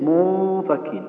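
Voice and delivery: منفكين drawn out very slowly, the noon hidden and nasalized before the fa; hidden nasal noon. Shown as a demonstration. Teacher showing the student the ikhfa, slow nasalized منفكين. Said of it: "أخفينا النون عند الفاء".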